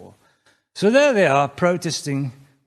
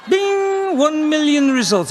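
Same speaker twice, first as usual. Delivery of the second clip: announcing Bing; 12 semitones higher, 9 dB louder, slower than usual. Acted. Bing answering eagerly.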